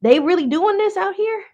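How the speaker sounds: high outraged squeal; disbelief. Real, not acted. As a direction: shriek in disbelief.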